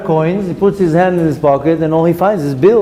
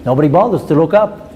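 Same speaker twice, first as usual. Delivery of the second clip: ordinary talk, nothing marked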